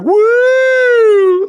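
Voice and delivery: high, loud mock "Woo!" of a rowdy fan; mock fan whoop. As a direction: yell "Woo!" like the guy egging them on. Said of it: "doing like a little shoulder check".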